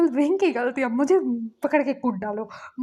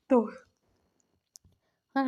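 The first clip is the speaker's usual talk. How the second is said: ordinary delivery, nothing marked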